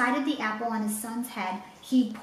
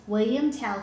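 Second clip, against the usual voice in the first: ordinary delivery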